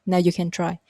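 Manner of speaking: ordinary talk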